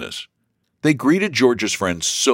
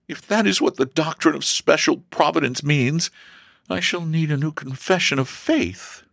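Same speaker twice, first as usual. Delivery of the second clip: high-pitched daughter's lament; sighing complaint; 11 semitones higher than usual. A narrator's reading. Daughter grumbles to her mother, sighing. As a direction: complain with a touch of dismay. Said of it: "sighed Elsie".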